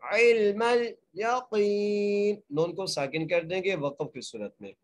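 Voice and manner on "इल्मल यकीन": very slow इल्मल यकीन, ain joined to lam; Quranic recitation. Shown as a demonstration. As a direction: draw out यकीन. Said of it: "एक अलिफ की मकदार खींच".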